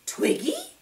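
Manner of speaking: very high surprised question. A narrator's reading. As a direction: squeak her name in surprise. Punchinello surprised.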